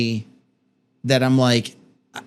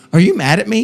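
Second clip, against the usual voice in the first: higher, louder mock anxious question; husband mimicking the wife's worried question, pitched up; 8 semitones higher, 5 dB louder, faster than usual. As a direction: imitate the wife asking, a little hammed. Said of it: "You look at me and go".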